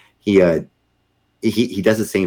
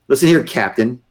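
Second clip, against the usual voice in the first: louder, higher scolding voice; retold stern yell; 7 semitones higher, 6 dB louder than usual. Angry general to the captain, retold stern yell. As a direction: dress down a junior officer. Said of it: "he yelled at me".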